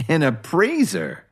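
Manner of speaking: slow, high incredulous scoff; scornful rising question. A narrator's reading. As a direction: draw it out in scornful disbelief. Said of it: "Johnny scoffed".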